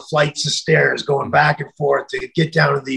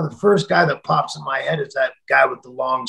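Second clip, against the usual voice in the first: normal talking voice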